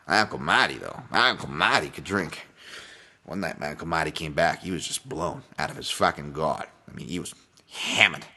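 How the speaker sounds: put-on Boston storyteller; Good Will Hunting Boston accent. Podcaster quoting a drinking-uncle movie tale in a Boston accent. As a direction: spin a bar yarn in a Boston accent. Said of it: "a little more Good Will Hunting for you".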